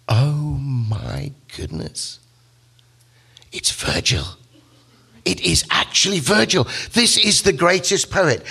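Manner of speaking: slow gasp, a whisper, then rising high with wonder; breathless awe at meeting a hero; awestruck recognition. Acted. Dante recognizing his idol Virgil in the dark wood, awestruck.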